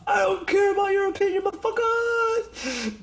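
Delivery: shrieking mock rant. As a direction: scream it like an unhinged influencer.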